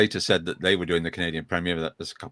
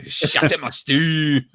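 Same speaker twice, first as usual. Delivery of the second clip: higher-pitched mock Scottish cry; imitated Scottish accent; 6 semitones higher, slower than usual. Host picturing his friend saying the line, mock Scottish accent. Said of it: "in my best Scottish accent".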